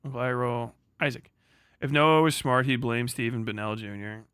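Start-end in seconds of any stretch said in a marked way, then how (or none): none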